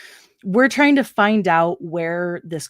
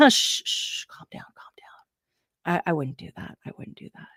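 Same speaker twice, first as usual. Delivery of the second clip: soothing whisper; 2 semitones lower, 7 dB quieter than usual. Retold or imitated. Coworker calming the scared man, soft whisper. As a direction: hush him like a frightened animal. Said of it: "he's like".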